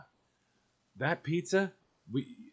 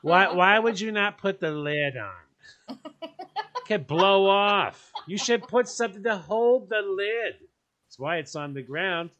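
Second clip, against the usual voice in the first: nosy neighbour; 6 semitones higher, 3 dB louder, slower than usual. Retold or imitated. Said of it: "they're gonna find you sitting".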